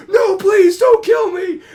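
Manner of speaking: very high, loud panicked scream; terrified begging. Retold or imitated. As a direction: beg for your life at full volume. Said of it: "He's like".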